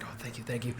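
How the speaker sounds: soft, breathy gratitude; whispered thanks. Retold or imitated. Relieved man thanking the driver, hushed gratitude.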